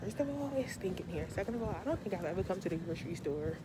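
muffled behind cloth mask; speaking with mouth covered; talking through a face mask. Real, not acted. Seen 0: Patterned cloth mask covers nose and mouth throughout; references unmasked.

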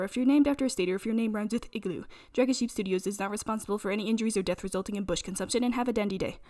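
fast, low disclaimer read; mock disclaimer. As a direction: rattle off the small print in a rapid monotone. Reads a mock safety disclaimer.

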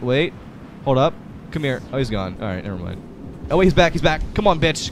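usual speaking voice

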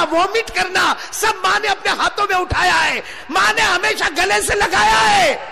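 high-pitched sermon shout; fiery preaching. Acted. Preacher declaring Allah's oath to the congregation, fiery preaching. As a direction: proclaim it at full pitch.

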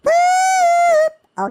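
very high, loud drawn-out boop; silly boop. Pretend. Player gets ready to shoot hoops, silly high hoot. Silly noise while playing.

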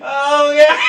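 ordinary talk, nothing marked

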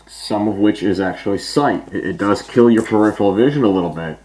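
muffled voice behind rubber mask; speaking through filter respirator; talking through a gas mask. Real, not acted. Seen 0: Full-face gas mask with filter worn throughout the labelled words.

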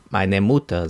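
plain reading voice

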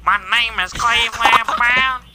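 high, slow cartoon dad voice; Cleveland Brown voice. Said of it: "sound like Cleveland".

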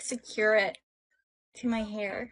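ordinary talk

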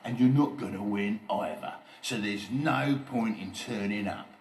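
loud, scornful bully voice; sneering. A narrator's reading. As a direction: crush their hopes with a loud sneer. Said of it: "sneered Oliver".

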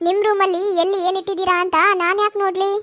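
normal talking voice

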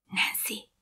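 hushed whispered call of a name. A narrator's reading. Keddi calls to Nancy in the dark, whispering. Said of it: "suttogta Keddi".

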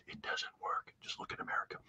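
hushed, voiceless whisper; whispered aside. Real, not acted. Advising the Chinese on their business model, confidential whisper.

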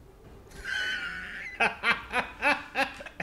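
high burst of laughter; laughing fit. Real, not acted. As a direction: crack up at the glitch. Se ríe del fallo del juego. (carcajada aguda)

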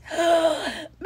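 sharp high gasp of delight. Real, not acted. Seeing the finished jar piece, a delighted high gasp. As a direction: gasp at how pretty it is.

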